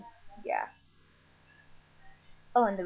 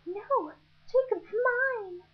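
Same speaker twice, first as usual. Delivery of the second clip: much higher, slow and possessive; high whiny Nessie; 13 semitones higher, 4 dB louder, slower than usual. Retold or imitated. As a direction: squeal it like a clingy teen girl. Nessie voiced in retold story.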